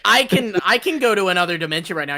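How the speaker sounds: put-on high, loud boastful child; bragging kid voice. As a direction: brag like a little kid. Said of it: "She's like".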